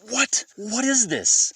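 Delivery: very high-pitched mock outcry; panicked disbelief. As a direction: squeal it in retold alarm and disbelief. Retold panic as friend bolts early.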